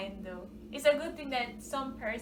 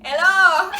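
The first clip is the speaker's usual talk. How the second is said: put-on laughing, loud Jamaican-accented Hello; 7 semitones higher, 18 dB louder than usual. Joking about how tourists should greet her, in Jamaican accent. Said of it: "if you had said it with an accent".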